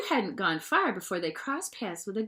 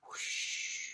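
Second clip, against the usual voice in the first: breathy whoosh of a fish darting off; whooshing swim-away noise; 12 dB quieter than usual. Acted. The fish puppet zooming off, a soft whoosh. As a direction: blow a quick swoosh sound.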